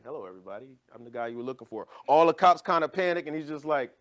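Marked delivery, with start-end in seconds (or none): 0.0-1.9 much softer, offhand greeting; mock nonchalant, quiet and easy; casual wanted man. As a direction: saunter in unbothered, barely raising the voice. Wanted man strolls into station.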